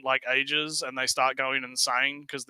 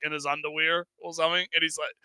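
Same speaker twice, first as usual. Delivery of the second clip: ordinary talk, nothing marked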